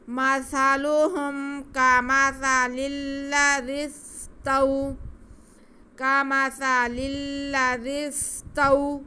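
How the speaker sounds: slow, high, loud chant; tajweed recitation. Shown as a demonstration. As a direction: recite phrase by phrase like a teacher. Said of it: "اس کو کھینچنا نہیں ہے".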